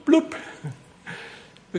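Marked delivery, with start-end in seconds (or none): 0.0-0.4 splash noise. Retold or imitated. Dog falls into ditch.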